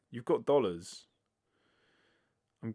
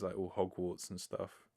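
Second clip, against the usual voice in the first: normal talking voice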